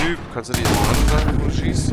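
agitated shout. Real, not acted. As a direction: yell at the teammate, worked up. Shouts at teammate in game. (aufgeregter Ruf)

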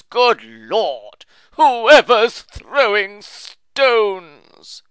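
high, loud, slow shriek of pain; agonized shriek. A narrator's reading. Old uncle hit by a stone, shrieking in agony. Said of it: "shrieked in agonizing tones".

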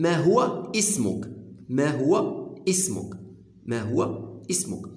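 slow, spelled-out teacher delivery; drilled translation, slow; slow model phrase. Shown as a demonstration. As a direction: say it slowly so learners catch each word. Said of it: "تنقول لك كيلي طون نون".

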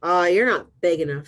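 loud, dismissive storybook brush-off; grouchy ladybug. Acted. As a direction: brush them off like a huffy bug. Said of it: "said the grouchy ladybug".

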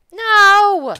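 high, loud shriek of dismay; anguished no. Real, not acted. Gamer shrieking as the character is about to perish. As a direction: cry it out as things go wrong.

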